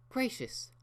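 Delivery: 高く驚いた声; はっと気づいて高い声で叫ぶ; startled exclamation. Acted. Rarity notices the late hour.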